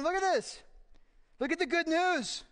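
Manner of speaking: high-pitched excited show-and-tell; excited spy. Acted. Spies showing Moses the good news, high and excited. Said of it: "didn't do it in that voice".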